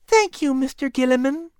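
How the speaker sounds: high-pitched polite bride; Sally's voice. Acted. Sally accepts the dance from Gilliman in a high voice. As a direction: thank him in a high feminine voice. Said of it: "Sally said".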